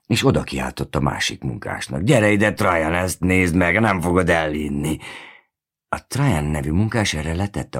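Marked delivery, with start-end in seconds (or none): 2.0-5.0 louder, higher call across the site. A narrator's reading. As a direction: call the other worker over, excited. Worker calls over to colleague.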